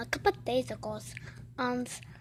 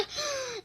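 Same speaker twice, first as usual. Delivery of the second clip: surprised gasp; 13 semitones higher than usual. Real, not acted. Surprised at two-sided burger.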